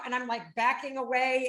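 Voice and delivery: ordinary talk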